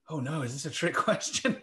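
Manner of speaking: a mock-worried question breaking into a laugh; laughing imitation. Presenter retelling the Texan's confusion, laughing. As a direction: play the puzzled listener, then crack up.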